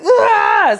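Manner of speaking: high, loud straining yell; a mock birthing scream, loud and high; pushing scream. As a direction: let out the final push. Final push.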